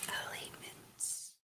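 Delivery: slow whispered Elements with long e; whispered echo. Real, not acted. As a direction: breathe Elements out slowly. Echoes the word whispered.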